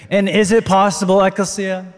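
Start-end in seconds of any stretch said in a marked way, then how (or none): none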